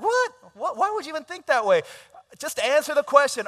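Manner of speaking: screeching high, loud what what; shocked outrage. Retold or imitated. Groom hearing about a prenup, shrieking in outrage. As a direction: shriek in appalled disbelief.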